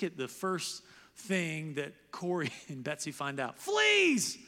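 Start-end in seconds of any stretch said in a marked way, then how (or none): 3.6-4.4 high, loud despairing wail. A narrator's reading. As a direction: wail it out in horror at the fleas. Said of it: "I wailed".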